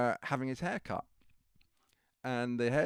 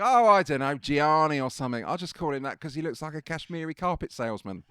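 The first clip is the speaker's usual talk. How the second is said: much louder, higher careless aside; offhand Bailey; 5 semitones higher, 13 dB louder than usual. Retold or imitated. Imitating Bailey shrugging off the hairdresser's real name. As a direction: toss it off, couldn't care less.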